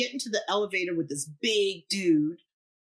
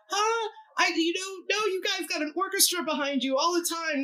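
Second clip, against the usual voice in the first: higher, panicked protest; freaking out; 12 semitones higher than usual. Retold or imitated. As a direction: voice shoots up, alarmed disbelief. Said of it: "I'm kind of freaking out".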